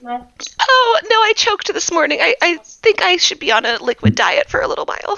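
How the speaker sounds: a hint of a nervous, fussy old lady; lightly voiced Bitsy. Bitsy answering the party's question about eating.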